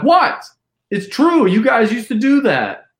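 high, loud retold outburst of disbelief; indignant protest. Drunk guest answering the shocked friend, mock-indignant high loud protest. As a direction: protest as if it's obvious. Indignant defence about the plantation remark.

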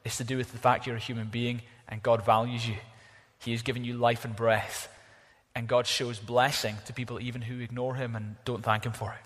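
ordinary talk

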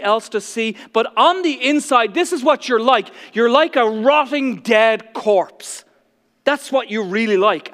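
loud, rising condemnation from the pulpit; fiery preaching. Acted. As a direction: preach it like a rebuke hurled at the Pharisees. Preacher voicing Jesus' rebuke.

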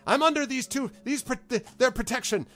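high, loud, flustered pleading; frightened stable hand hiding behind his protectors; scared stable hand. Acted. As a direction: cower behind the heroes and plead. Larg backs away scared.

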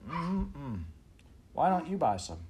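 put-on high, smug bragging kid voice; smug boy. A narrator's reading. Frank bragging with lemonade.

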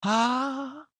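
high, sweet little sigh of a word. A narrator's reading. The creature in the box answers Pandora, high and sweet. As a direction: coo it high and small. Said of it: "said the sweet little voice again".

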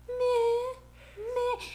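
high whiny dog crying imitation; imitating the puppy's whining on the floor; mock dog whine. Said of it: "she's on the floor".

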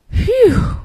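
high relieved phew; relieved sigh. A narrator's reading. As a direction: blow out relief after danger. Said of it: "sighed Grandpa".

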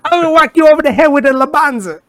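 goofy Italian grandma threatening to whack you; mock Italian threat. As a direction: threaten someone in an overdone Italian accent.

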